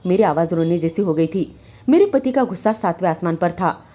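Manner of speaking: ordinary delivery, nothing marked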